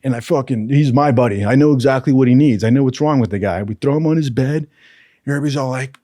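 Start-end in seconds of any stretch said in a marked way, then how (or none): none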